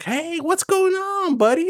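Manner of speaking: a sweet, high-pitched customer saying hello; cheery customer voice. Retold or imitated. Customer voiced greeting him.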